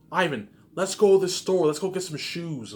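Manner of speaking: mock her eager shopping plea; imitating his wife. Imitating the wife's eager plea to go shopping. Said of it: "You're gonna see the way she talks".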